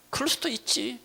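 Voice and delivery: high, soft, understanding concession; accepting. Acted. Preacher voices a believer facing betrayal, soft and accepting. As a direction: excuse it gently. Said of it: "사람들이 여러분들 배신할 때".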